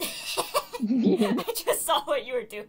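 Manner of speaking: laughing loudly while talking slowly. Real, not acted. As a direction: laugh through the words. Laughing at friend's prank.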